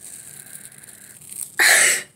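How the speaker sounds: loud laugh through real pain. Real, not acted. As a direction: laugh it off while it stings.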